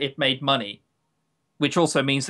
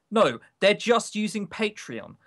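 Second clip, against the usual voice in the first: usual speaking voice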